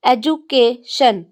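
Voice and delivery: Education slowly, one beat per syllable with pauses; broken into syllables. Shown as a demonstration. Teacher splitting the next example word.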